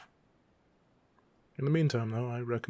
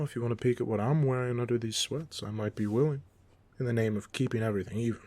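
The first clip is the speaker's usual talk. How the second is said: usual speaking voice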